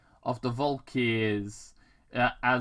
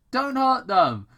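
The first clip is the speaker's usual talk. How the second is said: higher, louder plea; pleading hero; 11 semitones higher, 8 dB louder than usual. Acted. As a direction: beg him to spare them. Sonic pleads with Robotnik.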